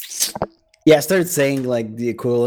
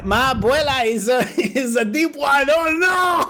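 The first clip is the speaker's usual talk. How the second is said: mock Spanish accent; 10 semitones higher than usual. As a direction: play up a silly Spanish caricature.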